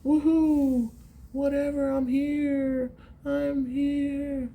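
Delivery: high falsetto cheer, then shrug; goofy happy player. Retold or imitated. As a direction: squeal like a carefree player. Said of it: "he's just happy to be there".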